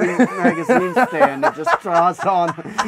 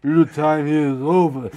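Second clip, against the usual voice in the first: movie villain line; 2 semitones lower than usual. Acted. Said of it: "He's like".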